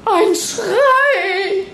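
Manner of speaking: high, breathless cry of news; excited announcement. A narrator's reading. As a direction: blurt it out excitedly. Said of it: "verkündeten nun aufgeregt". (aufgeregt verkündet, hoch)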